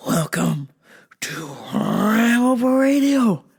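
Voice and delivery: drawn-out rising howl into a greeting; big, elongated podcast intro; hyped show opener. Real, not acted. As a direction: stretch the welcome out like a hype man. Host's hyped show opening.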